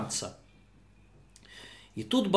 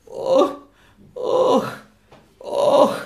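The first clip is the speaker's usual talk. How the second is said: groaning; 6 semitones higher, 3 dB louder, much slower than usual. Acted. Hurt man getting up.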